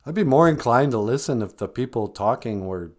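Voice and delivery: usual speaking voice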